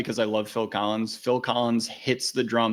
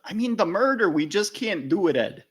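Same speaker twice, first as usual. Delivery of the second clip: higher Midwestern voice; mock officer; 9 semitones higher than usual. Officer warning Ed Gein, higher Midwestern voice. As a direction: let him down politely.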